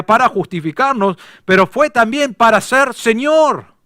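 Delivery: high, loud, driving sermon delivery; preaching at full force. Acted. Pastor urging the congregation to obey the Lord, preaching at full force. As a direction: preach it hard to the congregation, climbing.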